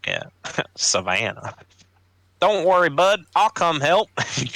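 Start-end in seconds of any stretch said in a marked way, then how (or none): 0.7-4.1 a Southern gent reassuring his buddy, pitched way up; Southern accent. Acted. Player voicing Gallant to the wounded pirate, hammed-up Southern accent. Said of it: "I'm so bad at accents".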